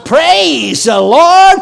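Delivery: high, loud, slow shout of praise; a woman's joyful shout of Praise the Lord. Retold or imitated. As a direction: cry it out to heaven, slowly. Retold woman praising God.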